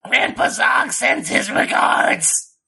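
slow, airy, sinister threat; menacing. Acted. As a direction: sends regards like a threat. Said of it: "That sounds, like, menacing".

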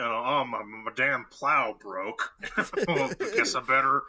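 hammy yokel grumble with a laugh; bored farmer voice. Retold or imitated. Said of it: "Sounds like a bored farmer".

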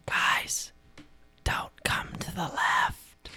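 slow, drawn-out whisper; hushed call from down the tunnel; whispered warning. Acted. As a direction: warn them like a faint echo. Faint echo warning from down tunnel.

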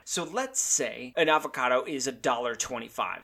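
ordinary delivery

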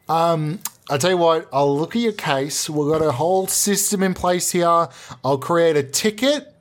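normal talking voice

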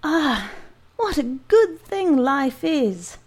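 slow, high blissful sigh; sigh of delight. Acted. Giant sprawled on sofa, blissful sigh. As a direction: savour every word. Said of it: "with a sigh of delight".

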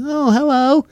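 a cat saying hello in a tiny high voice. Retold or imitated. Voicing a cat's greeting.

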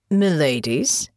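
slow, lightly voiced coachman; a hint of a gruff servant; slow, lightly voiced rural driver bowing to the ladies; rough driver. A narrator's reading.

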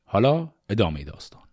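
usual speaking voice

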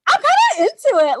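quick, high, giddy; quick giddy squeal. Retold or imitated. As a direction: squeal it like you're hooked. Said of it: "I was like".

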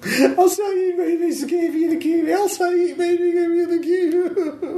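very high, slow squeaky voice; terrified informant. Acted. Frightened informant confesses to interrogators, very high and slow. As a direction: squeak it out in panic, slowly.

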